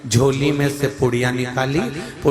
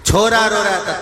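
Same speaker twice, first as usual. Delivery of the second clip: usual speaking voice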